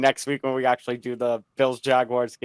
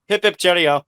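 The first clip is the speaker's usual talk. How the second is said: loud, chirpy British toast; British accent; 6 semitones higher, 7 dB louder than usual. Pretend. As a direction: cheer it like a jolly Brit. Mock British cheer sign-off.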